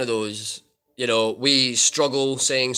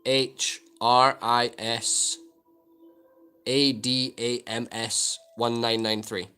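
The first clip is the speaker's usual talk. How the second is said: slow letter-by-letter spelling; dictating a handle, slow and careful; slower than usual. Shown as a demonstration. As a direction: spell each letter slowly and clearly. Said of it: "So C".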